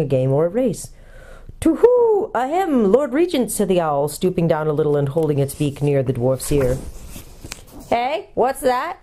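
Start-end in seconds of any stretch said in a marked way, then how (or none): 1.6-3.5 loud high hoot, then polite address; hooting owl. A narrator's reading. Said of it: "hooting kind of voice". 7.9-9.0 high, slow querying voice; hard-of-hearing dwarf. A narrator's reading. Deaf dwarf asks what was said.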